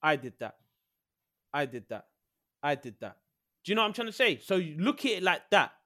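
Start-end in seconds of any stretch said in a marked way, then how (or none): 0.0-3.2 lightly voiced proud Van Dijk showing off trophies; a touch of smug pride, pointing at each trophy; mock boast, a bit lower, as the proud host; Van Dijk bragging. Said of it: "he will give you a tour".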